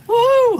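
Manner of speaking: loud high woo of joy; excited whoop. Real, not acted. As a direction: whoop with delight. Fish hooked, cheering on camera.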